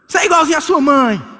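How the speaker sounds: husband throwing an insult in a fight; mocking husband. Husband insulting wife in quarrel.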